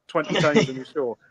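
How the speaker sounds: ordinary delivery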